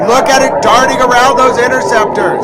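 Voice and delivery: loud, high, breathless hype over the footage; excited shouting. Real, not acted. Streamer shouting at missile footage on stream, excited yelling. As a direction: yell it like you can't believe what you're seeing.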